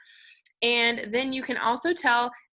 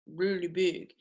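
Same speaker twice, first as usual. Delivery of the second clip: big low voice; 4 semitones lower, 3 dB quieter than usual. Shown as a demonstration. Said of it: "So say this with me".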